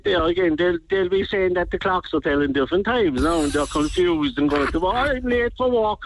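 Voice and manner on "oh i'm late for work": mock flustered commuter. Mimicking confused commuters.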